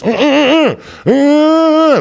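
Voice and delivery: high muffled gagged moaning through a gag; muffled trunk moans. Retold or imitated. Guy handcuffed in the trunk heard by the cop, high muffled moans. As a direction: play the gagged man thumping in the trunk.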